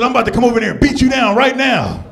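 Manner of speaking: high, loud mock threat; hammed-up big dude about to beat him down; threatening tough guy.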